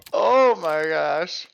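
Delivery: hiccuping between words; talking through hiccups. Real, not acted. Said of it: "The hiccups are just".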